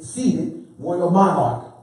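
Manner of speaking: usual speaking voice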